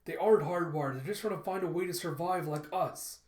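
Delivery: loud, insistent objection; exasperated protest. Acted. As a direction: argue back loudly. Acted heated argument in story.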